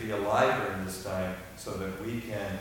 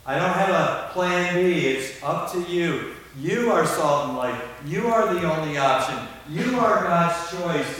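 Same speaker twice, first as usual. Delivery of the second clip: preaching at full force; 5 semitones higher, 7 dB louder than usual. Acted. Preacher urging the church to act, loud and emphatic. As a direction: pound each phrase home to the congregation.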